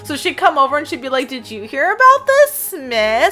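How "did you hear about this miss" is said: much higher and louder, hammed-up busybody; gossiping witch. Witch dropping by a neighbor with news, mock gossip. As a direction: play an eager gossip with juicy news. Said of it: "she also liked to share gossip".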